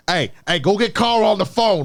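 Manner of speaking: put-on a hammy New York accent calling for Carl; terrible New York accent. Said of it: "it's a terrible New York accent".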